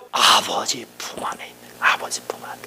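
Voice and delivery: soft unvoiced whisper, almost a breath. Shown as a demonstration. Preacher whispering about resting in the Father's arms, softly. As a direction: hush it like rocking a child to sleep. Hushed repetition of the Father's embrace.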